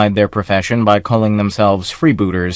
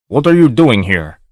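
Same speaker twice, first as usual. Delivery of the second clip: abrupt demand. A narrator's reading. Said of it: "the freebooter demanded abruptly".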